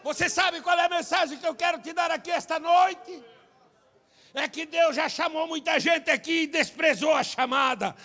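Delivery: high, loud, slowing preacher's cry; preaching at full force. Acted. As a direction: drive the message home to the crowd. Preaching climax.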